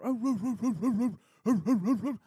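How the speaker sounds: loud, high imitated dog barks; barking imitation. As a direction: bark like an alarmed dog. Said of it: "River's just like".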